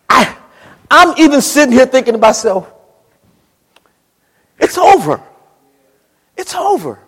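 high, loud preaching with a catch of crying; full-force preaching. Acted. Preacher crying out Paul's despair at full force. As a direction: cry Paul's despair to the church.

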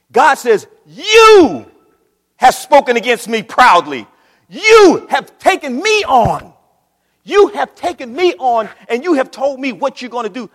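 preaching at full force. Acted. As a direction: thunder God's words over the church.

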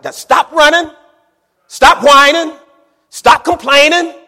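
loud, high preacher's shout; shouted command. Acted. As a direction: shout each order as Moses to the people. Moses commanding the complaining people.

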